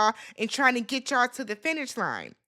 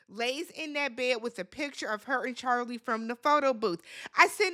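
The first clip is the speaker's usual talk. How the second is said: ordinary talk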